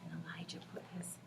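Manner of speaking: very soft whispered narration; whisper, almost nothing; storyteller's whisper. A narrator's reading. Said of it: "the very quietest whisper".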